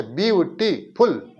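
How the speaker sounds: Beautiful said slowly with a cut before the last syllable. Shown as a demonstration. Teacher giving an example of a word with a cut, beautiful slowly split. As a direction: break beautiful into separate slow pieces. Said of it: "यानी कि जिसमें cut हो".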